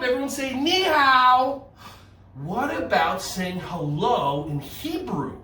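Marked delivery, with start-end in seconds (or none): 0.5-1.7 put-on high, loud model for kids to echo; sing-out high greeting; called-out greeting. Shown as a demonstration. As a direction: call it out big to a class. Leads kids calling out greeting.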